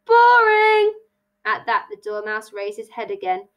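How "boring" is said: high, loud, drawn-out jeer; shouted heckle. Acted. Hare heckles Tilly's apology, shouting. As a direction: holler it to cut in.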